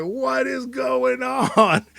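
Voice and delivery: high, very slow and drawn out. Pretend. Host voices [name] seeing the crazy jacket, high and slow. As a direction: stretch it in disbelief. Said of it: "[name] was like".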